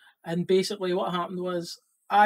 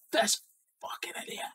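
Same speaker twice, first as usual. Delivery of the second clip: whispered, much higher, calling himself an idiot; self-mocking whisper; 8 dB quieter than usual. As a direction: hiss it at yourself in disbelief. Cursing himself after the story.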